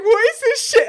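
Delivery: very high, very loud disbelieving shriek; shocked outburst. Retold or imitated. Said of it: "and I'm like".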